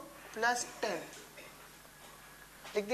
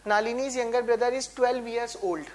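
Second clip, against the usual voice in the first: reading the question; much slower than usual. Shown as a demonstration.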